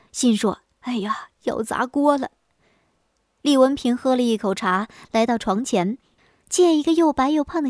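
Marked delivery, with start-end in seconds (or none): 0.8-2.3 whispered inner groan of dismay; 心里暗急. Acted. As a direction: fret to yourself under the breath. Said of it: "气得直跺脚， 心说". (气急地用气声心里嘀咕)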